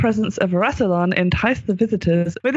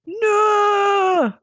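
anguished scream. Acted. Doctor over her burnt past self, screaming. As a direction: scream it in despair. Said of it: "I don't know how to scream now in Northern".

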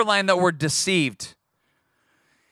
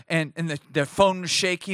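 ordinary talk

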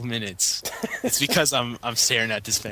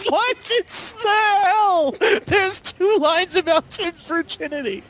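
squealing high through laughter; laughing hysterically; slower than usual. Real, not acted. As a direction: crack up while reading.

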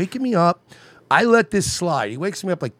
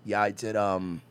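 bougie flight attendant; 7 semitones lower, 10 dB quieter, slower than usual. Retold or imitated. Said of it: "she's like".

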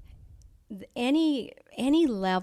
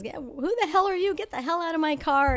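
higher, louder, fast mock yell at a carjacker; retold angry yell; 9 semitones higher, 5 dB louder, faster than usual. To the carjacker in her car, a higher, faster retold yell. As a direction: snap it out fast and high like telling a stranger to get out.

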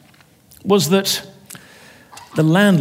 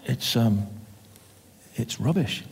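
timid tenant; 3 semitones lower, 10 dB quieter than usual. Acted.

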